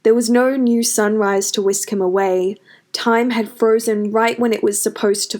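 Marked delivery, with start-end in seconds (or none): none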